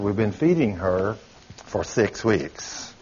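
slow and quiet, leaning on six; pointed reply. Retold or imitated. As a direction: let the six weeks land slowly. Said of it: "I said".

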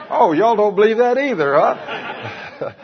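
laughing. Real, not acted. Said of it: "laughing at disbelief".

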